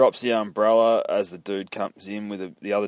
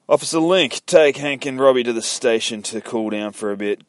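loud, raised-pitch command; calling out orders; 5 semitones higher, 8 dB louder than usual. Acted. Sheriff sending Officer Link off with boys, loud order. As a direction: call the officer over, then give orders firmly.

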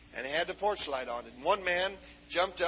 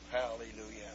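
ordinary talk, nothing marked

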